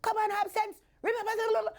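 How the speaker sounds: high, scolding mum; high-pitched mock scolding mother; stern mother. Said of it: "she's like".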